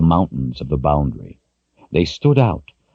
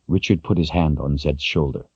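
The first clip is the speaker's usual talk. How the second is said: ordinary delivery, nothing marked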